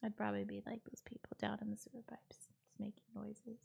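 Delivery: soft breathy whisper; whispered joke. Real, not acted. She jokes to chat about the sewer singers: hushed whisper. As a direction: confide it in a whisper.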